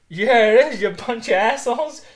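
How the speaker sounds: mock Mark Twain. Retelling the film, Mark Twain insults Holmes and Watson in a mock voice. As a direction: fling the insult like a smug celebrity. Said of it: "And Mark Twain goes".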